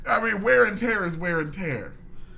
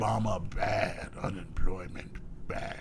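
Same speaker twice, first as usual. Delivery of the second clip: caveman voice; 9 semitones lower, 6 dB quieter than usual. Acted. Caveman parody of politicians.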